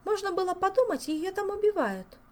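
put-on a young lady shaking her head in disbelief; lightly voiced Olga. A narrator's reading. Olga remarking on the viscountess's screaming. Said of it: "покачала головой Ольга".

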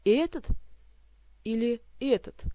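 jab at each этот as if choosing; irritated Elmar asking which drunk. A narrator's reading. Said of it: "раздражённо поинтересовался".

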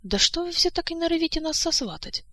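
high-pitched exasperated protest; acted fed-up outcry, not shouted; indignant. A narrator's reading. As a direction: object indignantly, voice pitched well up. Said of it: "возмутилась Ольга".

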